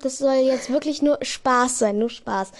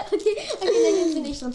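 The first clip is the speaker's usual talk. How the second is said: laughing; 6 semitones higher, faster than usual. Real, not acted. Laughing before her joke.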